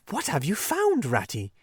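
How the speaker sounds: a small animal asking curiously; Mole's voice. Acted. Mole asks the Rat.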